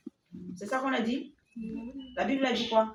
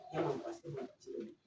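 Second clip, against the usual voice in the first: tendre murmure; 6 semitones lower, 10 dB quieter than usual. Acted. Maman câline son enfant.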